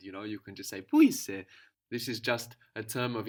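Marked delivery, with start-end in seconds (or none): 0.8-1.5 put-on Πού είσαι? louder and fond, for a cute child. Shown as a demonstration. Teacher playing someone cooing at a cute child. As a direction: coo it to a child you just met. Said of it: "if you meet a cute child".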